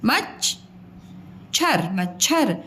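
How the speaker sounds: मच and छर split apart slowly, then मच्छर joined; slow classroom split of मच्छर; split syllable demo. Shown as a demonstration. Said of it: "च की sound कम आ रही है".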